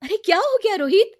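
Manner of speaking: high, loud worried sister; alarmed question. Acted. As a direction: call out in alarm to your brother. चीख सुनकर दीदी घबराई. (घबराई हुई दीदी का सवाल)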